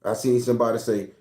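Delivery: ordinary talk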